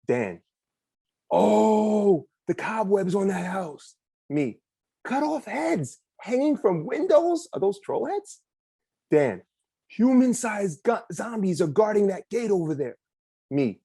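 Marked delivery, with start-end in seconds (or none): 1.3-4.0 excited. Acted. Dan voiced excitedly. 5.0-8.4 high, slow spooked excitement; excited. Acted. 9.9-13.0 excited. Acted. Dan voiced excitedly.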